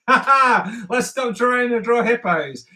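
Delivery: laughing through the words, slow and loud. Real, not acted. Artist laughing at his own bad hippo drawings, loud and slow. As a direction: give up on the hippos mid-laugh.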